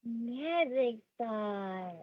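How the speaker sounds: slow magic announcement. Acted. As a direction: draw it out like a magic trick. Announcing a reward to a child.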